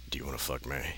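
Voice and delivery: soft, creepy low murmur. Acted. The teacher propositions Shadow in a soft creepy murmur. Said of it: "he said".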